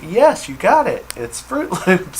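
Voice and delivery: high, loud, thrilled reply breaking into a laugh; delighted exclamation. Retold or imitated. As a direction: cheer a right guess, laughing at the end. Acts out her delighted reply to partner.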